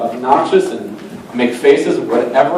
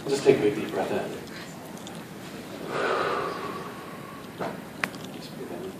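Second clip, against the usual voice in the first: hypnotic induction; 6 semitones lower, 20 dB quieter than usual. Acted. Stage hypnotist softly putting volunteers under, soothing murmur. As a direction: lull them down into trance.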